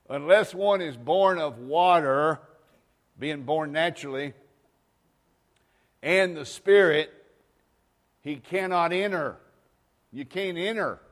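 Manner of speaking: loud, high, fervent preacher cry; full-force preaching. Acted. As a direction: proclaim it like thunder from the pulpit.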